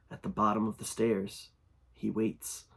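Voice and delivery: hushed and eerie, a low intrusive murmur; a voice drilling into the head, kept soft; eerie creature voice. Acted. Creature repeating its message to the narrator, hushed and eerie. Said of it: "the voice returned".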